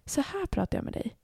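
hushed, close to the microphone; demonstrated whispering. Shown as a demonstration. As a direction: mimic your own near-whisper podcast voice. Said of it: "Jag viskar typ in i podden".

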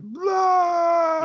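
loud, high comic wail; mock bawling. Teasing a friend about crying at a film, mock wail. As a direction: imitate a friend bawling, one long hammed-up cry. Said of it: "I could imagine you were just like".

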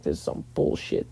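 low whispered gripe under the breath; a sour aside whispered to himself; whispered grumble. Retold or imitated. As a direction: whisper it so the table can't hear. Grumbling at loud neighbours' talk.

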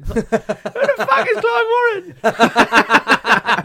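hammed-up Gervais cackling disbelief; Ricky Gervais impression. Mocking a film casting for friends, hammed-up laughing Ricky Gervais. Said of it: "Your Ricky Gervais is a lot better".

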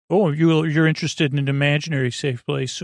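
ordinary delivery

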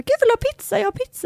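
high-pitched imitation of an eager neighbour; mock eager offer. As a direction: pipe up with an over-keen offer. Acts out neighbour's eager food offer.

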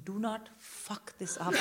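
slow, hushed, deliberate warning; a quiet, measured threat; tense inner warning. Retold or imitated. Silent warning to her kid.

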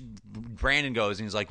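ordinary talk

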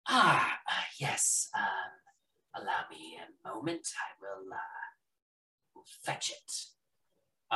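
hushed, breathy merchant; whispery shopkeeper voice. Acted. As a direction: murmur it like a discreet shopkeeper.

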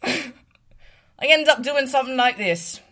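high, loud put-on British accent. Shown as a demonstration. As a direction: break into a silly British accent. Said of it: "doing my little British accent".

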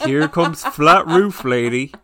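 slow, loud teasing announcement; mocking agent. Agents teasing the flat-roof lady, slow and loud. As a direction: drawl it out to mock her. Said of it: "they would have been like".

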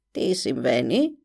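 put-on low, grim old witch. A narrator's reading. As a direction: croak it like a sinister witch. (φωνή μάγισσας)

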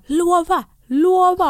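high, loud pleading command; retold insistent demand. Labouring woman making the midwife promise, loud insistence.

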